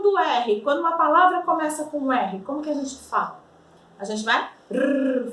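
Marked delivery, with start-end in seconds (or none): 4.7-5.3 rolled trilled rrr. Shown as a demonstration. Teacher demonstrating the Spanish R, strongly rolled. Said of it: "forçar esse R aí".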